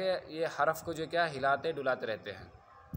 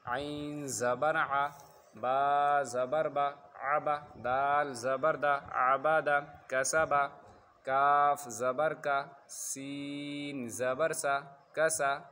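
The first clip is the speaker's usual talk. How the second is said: slow, loud chant with long-held letter names; measured drill, drawn-out letters and short syllables; spelling recitation; 4 dB louder, much slower than usual. Shown as a demonstration. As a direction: recite like a Qaida teacher, stretching each letter.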